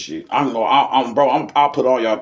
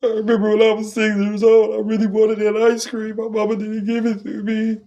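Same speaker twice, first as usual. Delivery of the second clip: put-on high, whiny drunk weeping voice; a drunk gone weepy over childhood; hammed-up tearful drunk, much higher; drunk crying; 11 semitones higher than usual. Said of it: "Then you start crying".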